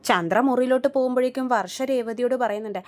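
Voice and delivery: normal talking voice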